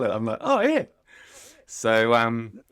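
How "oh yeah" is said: retold "oh yeah" jumping much higher; mock surprise, voice leaping up; surprised realisation. Feeling the broken rib the physio found, in retold surprise.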